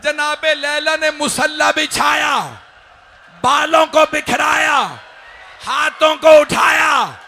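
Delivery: high, slow cries building to a loud, long-held supplication; impassioned majlis preaching. Acted. Hussain asks Laila to pray for their son, impassioned preaching. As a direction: cry the prayer out to the whole gathering.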